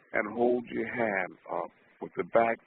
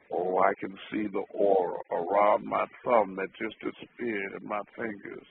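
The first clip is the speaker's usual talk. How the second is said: usual speaking voice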